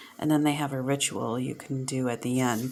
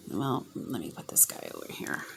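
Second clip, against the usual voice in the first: whisper, much softer; whispered aside; 5 semitones lower, 11 dB quieter than usual. Real, not acted. Reader murmuring while placing the card aside, whispered. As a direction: whisper it to yourself.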